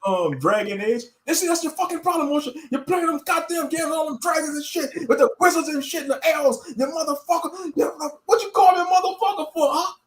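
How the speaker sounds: a preacher berating a friend over video games; mock zealot scolding. Acts zealot scolding friend Moshe.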